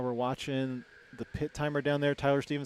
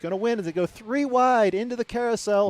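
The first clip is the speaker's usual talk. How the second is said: higher, louder thrilled call; excited race call; 6 semitones higher, 8 dB louder than usual. Real, not acted. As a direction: shout the pass as it unfolds. Three-wide pass, rising excitement.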